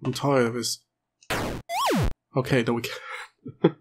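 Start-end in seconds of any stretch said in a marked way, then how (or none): none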